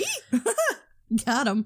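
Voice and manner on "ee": very high little "eee" squeal; delighted squeal. Retold or imitated. Teasing friend who says y'all, high delighted squeal. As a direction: squeak with mock-excited delight. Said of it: "whenever you say y'all, I'm like".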